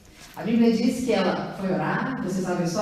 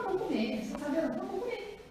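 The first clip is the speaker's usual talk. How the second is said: hushed Ana voice; 5 semitones higher, 11 dB quieter, faster than usual. Retold or imitated. Ana voiced deciding to eat.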